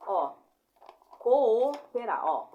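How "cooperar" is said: "cooperar" alto e arrastado, com os dois O separados; hiato em cooperar. Shown as a demonstration. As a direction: separe bem as vogais em "cooperar". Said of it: "uma vai pra cada lado". ("cooperar" bem silabado, um O para cada lado)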